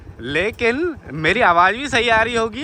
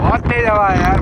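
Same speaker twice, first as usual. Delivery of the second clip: raised voice against the wind; shouting over wind; 2 semitones higher, 8 dB louder than usual. Real, not acted. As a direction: call it out over the gusts. Strong wind on field.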